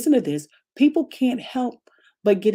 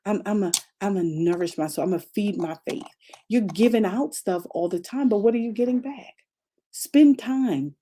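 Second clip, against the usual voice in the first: ordinary delivery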